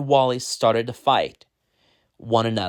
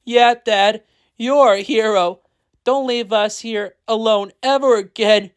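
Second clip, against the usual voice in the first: put-on high, pleading child voice, mildly tearful; crying; 9 semitones higher than usual. A narrator's reading. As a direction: plead with Dad, a touch of crying.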